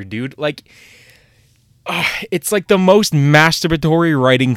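loud, high, fed-up rant; a frustrated, exaggerated yell; exasperated outburst. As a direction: blow up at the pointless answer. Mock outrage at the monologue.

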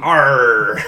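gruff pirate "arr"; pirate growl. Acted. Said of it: "Like the pirate said".

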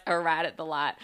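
broad country Southern vowels; straight Southern accent. Shown as a demonstration. Speaker laughing at her own slip, straight Southern accent. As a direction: lean into a Southern twang on it. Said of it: "I went straight Southern on that one".

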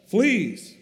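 sudden high, loud cry of disgust; cried out. Acted. Corrie discovering fleas in the barracks, a sudden loud cry. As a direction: yelp it out as something bites. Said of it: "I cried".

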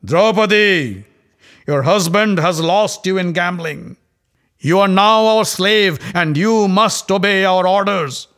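roaring. Acted. Dussasana roaring at Draupadi from her door, loud and gloating. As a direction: roar it from the doorway, gloating. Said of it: "and roared".

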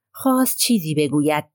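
ordinary delivery, nothing marked

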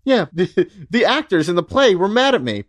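high, put-on innocent voice; mock casual non sequitur. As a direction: play it as a breezy, totally unrelated aside. Acting out an unrelated remark as Paul.

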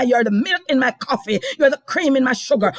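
usual speaking voice

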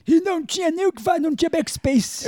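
put-on high, quick old man grumbling; grumpy old man. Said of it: "esse diálogo da terceira idade". (velho resmungão, agudo e rápido)

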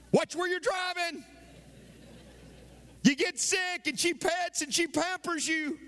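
high, sustained pulpit cry; impassioned preacher driving each phrase home; full-force preaching. Real, not acted.

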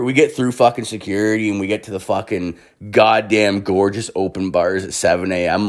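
ordinary talk, nothing marked

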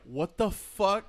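a slow, drawn-out "what the fuck" broken by laughter; stunned disbelief. Retold or imitated. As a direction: stretch it out in shocked disbelief. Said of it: "I was like".

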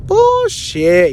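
high, drawn-out retold scoff; mock disbelief. Answering his cousin's warning about cops, disbelieving.

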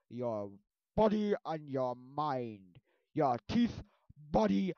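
loud, slow, put-on stern father voice; strict dentist dad. Dentist father bans candy from his house, loud and stern. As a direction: lay down the law about candy, slowly. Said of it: "he's like, so it's like".

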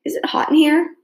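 high, faintly queasy question; weak, unwell. A narrator's reading. Claire about to throw up at dinner, weak and high. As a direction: ask it high, as if feeling ill. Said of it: "she said weakly".

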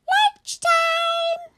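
very high, loud sing-out; squeaky mum calling upstairs. Acted. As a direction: call the kids in a piercing squeak. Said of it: "Mummy called them".

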